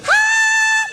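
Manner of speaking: very high held "ah"; perfect voice. Retold or imitated. Musician imitating angel voice on the recording, very high held note. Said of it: "There's this perfect voice that comes".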